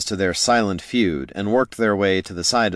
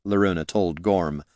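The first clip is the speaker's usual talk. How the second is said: usual speaking voice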